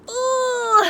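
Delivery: put-on high playful ooh for the kids; a teasing high ooh; mock ooh. Teacher teasing students about grubs with a high ooh.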